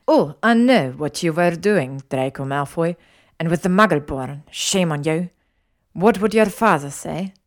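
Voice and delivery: loud, scolding Italian mother figure; stern lecture in an Italian accent; lecturing. Acted. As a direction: scold him like a disapproving matron. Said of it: "She lectured".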